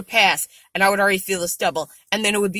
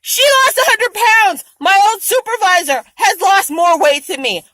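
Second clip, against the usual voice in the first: much higher, louder disbelief; incredulous exclaim; 9 semitones higher, 5 dB louder than usual. Real, not acted. Telling viewers about a friend's weight loss, incredulous and higher. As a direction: marvel out loud at her loss.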